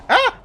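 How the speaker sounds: short, loud, high yelp; startled yelp. Real, not acted. Player jumped by an enemy, startled yelp. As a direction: yelp in sudden fright.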